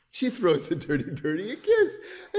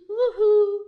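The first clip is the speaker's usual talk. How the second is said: high, louder cartoon hoot; calling woohoo; 12 semitones higher, 6 dB louder than usual. Halima to crying Dirty Birdie, high calling woohoo. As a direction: call it out in a high voice.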